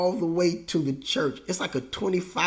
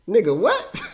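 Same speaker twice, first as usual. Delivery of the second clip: incredulous comeback; 5 semitones higher, 4 dB louder than usual. Acted. Child's stunned comeback to dad.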